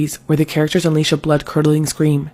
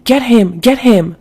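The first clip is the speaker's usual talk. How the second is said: high-pitched urgent cry, only slightly louder; urgent narrated yell, pitched up; mock scream; 7 semitones higher, 4 dB louder, slower than usual. A narrator's reading. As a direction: act out an understated scream to go get someone. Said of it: "My mom was screaming".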